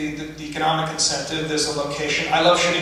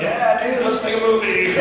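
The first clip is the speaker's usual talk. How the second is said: higher-pitched, easygoing dude act; mock breezy agreement; 5 semitones higher than usual. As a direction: play at being the laid-back, agreeable pal. Sarcastic make-believe easygoing reply to actor.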